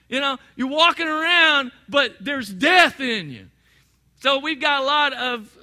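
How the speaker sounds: fired-up sermon delivery; preaching at full force. Acted. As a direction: drive the zombie image home. Preaching on zombies.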